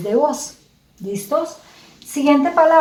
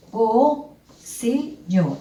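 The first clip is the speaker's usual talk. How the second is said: "Pocillo" slowly as po... ci... llo; separated syllables; much slower than usual. Shown as a demonstration. Teacher separates the word for pupils, separated syllables. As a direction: separate "Pocillo" into three beats. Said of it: "vamos a separar".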